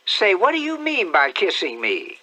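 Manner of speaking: outraged aunt after a stolen kiss; indignant aunt. Acted. Aunt Sally scolds.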